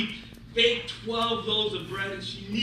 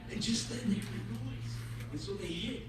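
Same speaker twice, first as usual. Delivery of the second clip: ordinary delivery, nothing marked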